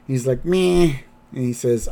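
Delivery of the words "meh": higher, unimpressed meh; mock listless little noise; mildly depressed. Grandpa not thrilled atop the roller coaster, listless. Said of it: "He's just kind of depressed".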